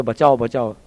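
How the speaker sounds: low, soft tossed-off mumble of the cry; understated call for help; mild retold cry. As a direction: say it quietly, almost to yourself. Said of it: "लोग बोल रहे".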